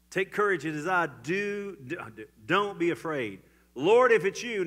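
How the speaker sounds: rousing sermon voice; preaching at full force. Acted. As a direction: proclaim it boldly from the pulpit. Preaching the scene again.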